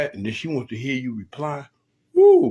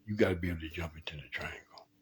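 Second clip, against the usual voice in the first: low whispered secret advice; 7 semitones lower, 10 dB quieter than usual. Real, not acted. Host confiding relationship advice to the fellas, whispered. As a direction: lean in and whisper it.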